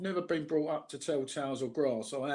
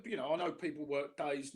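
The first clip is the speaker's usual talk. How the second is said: ordinary talk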